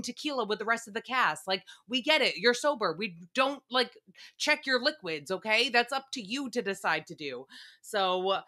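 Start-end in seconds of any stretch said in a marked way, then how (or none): none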